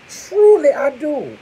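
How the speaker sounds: very high loud insistence; high loud earnest declaration; emphatic squeal. Real, not acted. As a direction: squeal it to prove it.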